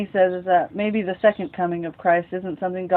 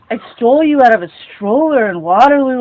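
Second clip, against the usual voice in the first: mom blurts it out, higher and louder; a raised, higher outburst of the truth; loud confession; 5 semitones higher, 9 dB louder than usual. Acted. Mother blurts her confession to Victor, higher and louder.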